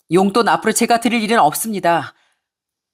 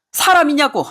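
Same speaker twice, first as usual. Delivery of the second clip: furious demand; 7 semitones higher than usual. Acted. Mother berating her cheating son, furious. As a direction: hurl the question at him.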